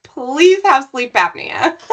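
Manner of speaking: high, drawn-out wishful plea; slow, high-pitched hopeful "please"; mock pleading. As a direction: beg for it, half joking. Hoping hard for a surgery-qualifying result.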